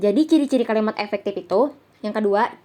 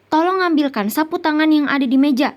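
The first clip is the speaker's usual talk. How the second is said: higher-pitched imperative example; raised voice for a textbook request; demonstrated request; 6 semitones higher, 3 dB louder than usual. Shown as a demonstration. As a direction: ask for it as a model polite command. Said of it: "ingin menyuruh atau meminta sesuatu".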